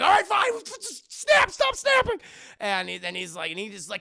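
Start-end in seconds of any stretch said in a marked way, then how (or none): 0.0-2.2 high, loud cracking shout; mock meltdown. Brad yelling at the café to stop snapping. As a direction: lose it over the snapping.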